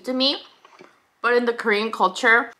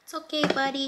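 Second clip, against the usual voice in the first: soft, higher comforting voice; gentle reassurance; 4 semitones higher, 8 dB quieter, faster than usual. Real, not acted. Owner telling her pet it is okay, gently. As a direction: soothe a worried little pet.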